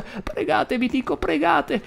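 frantic high grandma shout; furious. Acted. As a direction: shriek it at him, furious granny. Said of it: "ripeteva furiosa".

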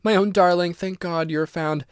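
put-on mother murmuring to her found child; murmured. A narrator's reading. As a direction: murmur it, holding her close. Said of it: "murmured Missus Otis".

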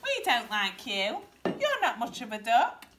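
loud, scornful storybook ducks; quacking ducks. A narrator's reading. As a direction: reject him loudly, swooping in pitch. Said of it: "they quacked".

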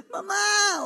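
put-on a child's high cry for mama in the dark night.

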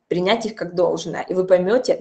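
ordinary delivery

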